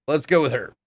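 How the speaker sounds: usual speaking voice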